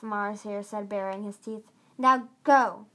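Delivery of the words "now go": loud command. Acted. Marsier orders them.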